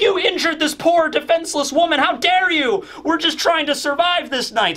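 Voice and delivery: high, indignant accusation; accusing women. Retold or imitated. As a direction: scold him in a high, outraged voice.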